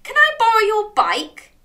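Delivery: loud, high crab voice; eager crab. A narrator's reading. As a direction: ask loudly and eagerly as a crab. Crab eagerly asks Dog.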